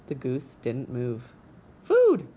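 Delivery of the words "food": high, loud chick squeal; hungry gosling. Acted. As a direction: shriek for food like a hatchling. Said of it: "peeped the gosling".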